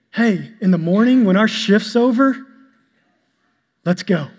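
ordinary talk, nothing marked

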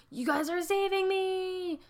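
mock overjoyed. Aguilar thanking his rescuers, hammed-up giddy delight. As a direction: play the rescued man, giddy with relief. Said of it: "And he's, like, so happy".

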